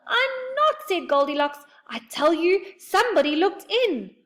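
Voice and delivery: high, loud frightened insistence; scared, high insisting; insistent fright. A narrator's reading. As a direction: insist she saw someone.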